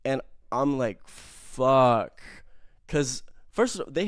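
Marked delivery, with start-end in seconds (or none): 1.1-2.2 sudden loud "Fuck"; loud dismayed curse. Retold or imitated. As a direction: blurt it out in shock. Realizing he had ketamine.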